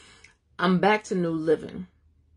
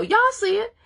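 excited exclamation; 5 dB louder than usual. Real, not acted. Exclaiming to the congregation.

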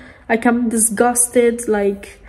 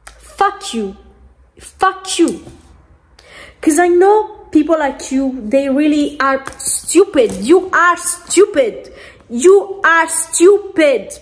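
higher-pitched, indignant rant, drawn out at the end; real angry insult; 5 semitones higher than usual. Real, not acted. Vlogger telling off people they despise, angry. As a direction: spit out the insult, stretching the last words.

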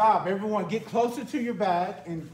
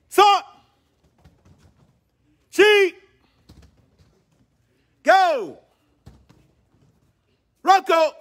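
loud high shouted rep count; counting workout reps; 7 semitones higher, 8 dB louder than usual. Real, not acted. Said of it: "I'm gonna count 'em out for you".

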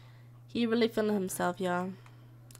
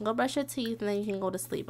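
normal talking voice

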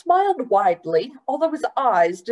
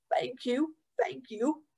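put-on much softer old man's thanks; quiet thanks; 5 semitones higher, 9 dB quieter than usual. Mister Randolph thanking Gilly after she catches him, soft. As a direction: murmur gratefully.

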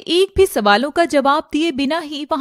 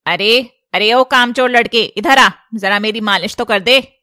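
louder, lower scolding summons; loud nagging mother-in-law; bossy old woman calling; 4 semitones lower, 5 dB louder than usual. Acted. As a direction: call out to the lazy lad across the house. Kalyani calls the lazy boy.

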